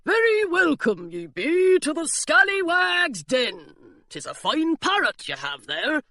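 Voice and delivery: hearty pirate welcoming a guest; burly pirate. A narrator's reading. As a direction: play a lazy dockside pirate.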